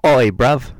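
very loud mock British shout; bad British accent. Co-host greets the host back from England, bad British accent. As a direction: blurt it in a hammed-up British accent. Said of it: "I'm doing, like, a fucking New Zealand accent".

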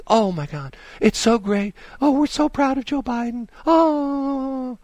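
high, swooning mock gush. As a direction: ham up two cooing anchors.

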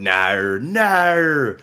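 exaggerated Australian "no", drawn out; high, hammed-up Australian vowel; Australian accent. Shown as a demonstration. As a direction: stretch it into a rising Australian wail. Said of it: "I can't say anything else aside from".

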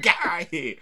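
high, loud shout of get out of here; shouted brush-off; loud dismissal. Retold or imitated. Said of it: "I didn't say".

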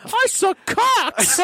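high, slow squeaky mock voice. A player mocking another with a high squeak. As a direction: squeak it out slowly to tease.

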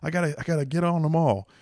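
high, eager bridge-fanatic voice; obsessed fan. Retold or imitated. Mocking a one-lane bridge fanatic, high and eager. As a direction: gush like someone hooked on one-lane bridges. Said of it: "this fetish where they're like".

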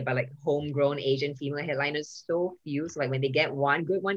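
ordinary delivery

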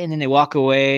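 ordinary talk

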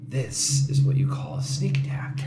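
soft sly whisper. Pretend. Player whispers to Ben before dropping on goblins, sly whisper. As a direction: whisper it conspiratorially to a friend. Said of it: "just quietly whisper to Ben".

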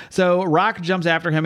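ordinary talk

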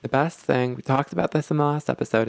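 ordinary delivery

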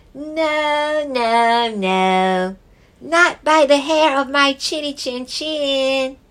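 high squeaky piglet refusal; small pig saying no; little pig voice. Acted.

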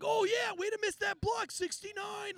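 high-pitched mock heckler's holler; heckling. Drunk kid jeering a player from row three, imitated heckling. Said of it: "he keeps continuing to, to heckle".

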